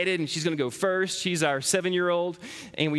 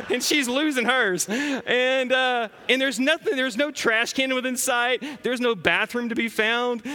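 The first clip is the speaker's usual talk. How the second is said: laughing; 6 semitones higher than usual. Real, not acted. Laughing through embarrassing mall story.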